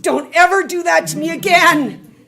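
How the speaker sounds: high, very loud mock scold; relieved scolding. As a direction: cry it out in exasperated relief. Hugged him in relief after scare.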